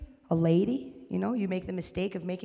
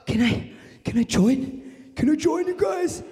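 breathless, whispered, then rising high eager plea; whispered wannabe, climbing to a high plea; creepy dude; 7 semitones higher than usual. Retold or imitated. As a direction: play the creeper begging to join. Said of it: "he's like".

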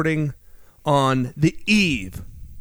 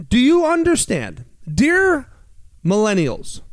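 exasperated; 7 semitones higher, 3 dB louder than usual. Real, not acted. Lecturing millennials, raised voice.

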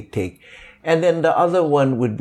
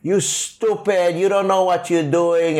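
mock scolding; 7 semitones higher than usual. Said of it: "don't come down on them with".

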